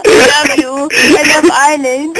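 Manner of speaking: shouting through laughter; laughing. Real, not acted. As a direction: cry out mid laughing fit.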